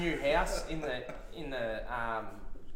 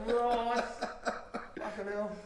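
normal talking voice

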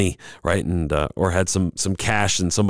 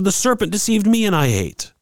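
higher, excusing tone; lightly voiced woman; 11 semitones higher than usual. The woman blaming the serpent, in a higher voice. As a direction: pass the blame up high. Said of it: "She said".

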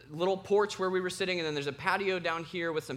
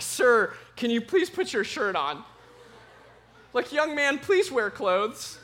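mock-polite appeal, raised in pitch and volume; higher, louder comic exasperation; exasperated plea; 6 semitones higher, 6 dB louder, slower than usual. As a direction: plead like a scandalised dad, half laughing. Imagined pleading with shirtless kid.

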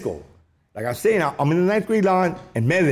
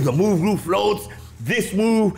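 ordinary talk

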